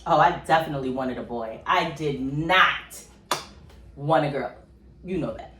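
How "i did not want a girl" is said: slow, loud, drawn-out not; emphatic no. Real, not acted. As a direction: stretch out did not. Firm about wanting a boy.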